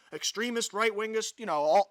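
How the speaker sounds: ordinary talk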